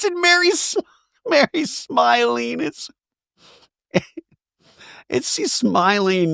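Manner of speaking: can't stop laughing while telling it; delighted laughing narration. Real, not acted. Laughs while describing the hug.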